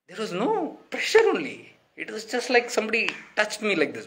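amazed recall. Real, not acted. Telling listeners how gentle the slap felt, amazed. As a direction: marvel at how light the touch was.

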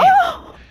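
sudden high gasp of shock; sharp gasp. Real, not acted. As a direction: gasp in alarm as you get hit. Ambushed in the game.

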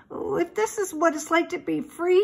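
lightly voiced piglet thinking aloud; small, high voice of a young pig; young pig's worried thought. A narrator's reading. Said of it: "he thought".

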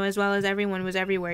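usual speaking voice

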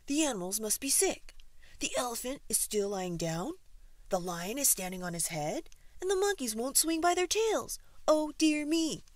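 rising to high, loud dismay; fretting zookeeper. A narrator's reading. Keeper listing what is wrong, mounting alarm. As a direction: build the worry to a high cry. Keeper frets over sick animals.

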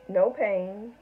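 usual speaking voice

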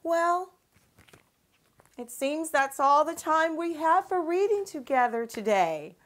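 usual speaking voice

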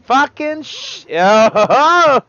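high, slow, drawn-out frustrated whine; frustrated wail. Real, not acted. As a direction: wail it out at the game. Frustrated gamer swearing at game.

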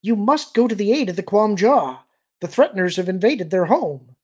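put-on high, loud young alien voice; urgent alien plea. Child of Winds seeks aid for Quam Jaw, urgent.

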